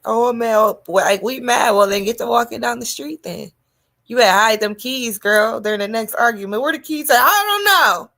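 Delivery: loud, high mock of a boyfriend in a fight; mocking the man. Teasing the listener's man in a fight, loud high mock voice. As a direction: bellow it like the smug boyfriend who took the car.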